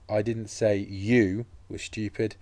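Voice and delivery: YOU set off and drawn out, slow; slow sentence, lean hard on the word YOU; stress on YOU. Shown as a demonstration. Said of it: "put the stress into different places".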